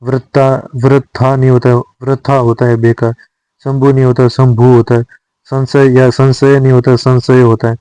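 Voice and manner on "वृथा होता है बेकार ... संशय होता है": ordinary delivery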